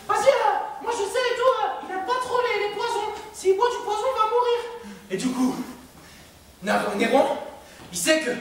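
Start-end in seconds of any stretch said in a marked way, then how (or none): 0.1-4.6 very high squeaky voice; squeaky Narcisse. Retold or imitated. Narcisse offering help to Néron in a very high squeak. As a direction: play a sly little snitch in a squeak. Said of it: "il lui fait".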